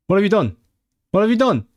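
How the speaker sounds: high, loud, shocked question. Real, not acted. Streamer to a sorry chatter, loud and aghast. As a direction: demand to know what happened, aghast. Reacting to chat apology.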